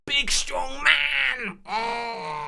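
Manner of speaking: very high, drawn-out squealed strong-man roar; mock strongman. Caller showing off as a big man, high hammed-up strongman cry. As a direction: stretch it into a strained, high-pitched mock yell. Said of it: "I thought everyone required a visualization".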